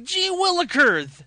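put-on high, loud, drawn-out old-timey cry; hammed-up wholesome kid exclamation; ridiculous thirties exclamation. Host joining the bit with a 1930s exclamation, high and hammed-up. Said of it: "some ridiculous nineteen thirties, uh, exclamation like".

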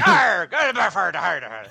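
sailor mode. Pretend. [name] showing his full sailor mode, hammed-up arr. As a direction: ham up a cursing old sailor. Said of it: "full sailor mode".

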